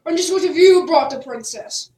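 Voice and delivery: loud, stern demand; royal guard. Acted. Guard to Jack at the gate, loud and stern. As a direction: challenge the boy officially. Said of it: "asked the royal guard".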